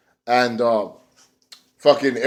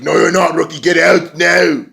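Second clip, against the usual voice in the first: mock angry order; 4 semitones higher, 4 dB louder than usual. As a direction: throw the rookie out. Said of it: "Drew Galloway's like".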